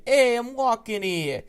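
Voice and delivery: put-on Boston accent. Big brother teaching baby sister a Boston accent. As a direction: lean hard into a Boston accent, drawn out. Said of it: "Speak in Boston accent".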